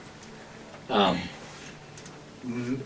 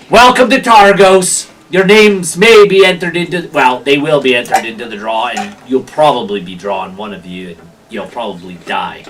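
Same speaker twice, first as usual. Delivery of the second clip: gatekeeper's proclamation; 3 semitones lower, 7 dB louder than usual. Acted. Gatekeeper welcomes newcomers to the draw, booming then offhand. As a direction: proclaim it grandly, then let it drop away.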